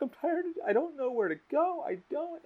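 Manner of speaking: high, plaintive imitation of crying; imitated bawling. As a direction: act out a friend's despairing wail. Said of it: "fucking full on bawling".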